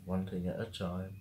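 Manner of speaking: softly talking herself down; murmured to herself, settling her nerves; quiet self-reassurance. Real, not acted.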